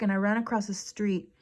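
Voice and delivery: normal talking voice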